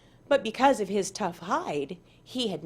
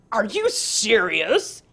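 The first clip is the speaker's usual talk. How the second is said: amused disbelief; 5 dB louder, slower than usual. Acted. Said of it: "Tiger looked amused".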